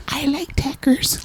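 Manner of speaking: high, soft British girl attempting an American accent; mock American accent. British girl answering friend about tacos, badly faked American accent. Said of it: "trying to fit in and have an American accent".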